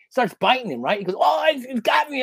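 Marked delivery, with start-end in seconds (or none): 1.2-2.2 higher, alarmed retold cry; alarmed mock yelp. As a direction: act out being caught by a bite. Retold eel bite in a story.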